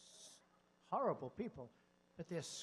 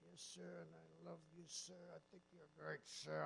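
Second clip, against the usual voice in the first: much softer, earnest devotion; hushed soldier; 12 dB quieter than usual. Retold or imitated. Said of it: "What's your name, Sergeant".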